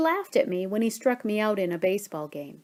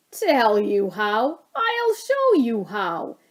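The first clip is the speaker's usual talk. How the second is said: dad's voice; 5 dB louder, slower than usual. A narrator's reading. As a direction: ham up the knowing dad. Dad voiced in story.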